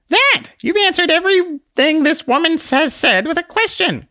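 very high, tiny squeaky voice; a tiny exasperated sprite scolding; squeaky little Conscience. Acted. Conscience snaps at the man in a very high squeak.

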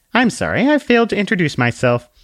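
high, polite little lady; curtsy it out sweetly, voice up high; prim little book voice. Acted. Book introduces herself to the man with a high dainty bow.

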